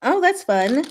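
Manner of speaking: normal talking voice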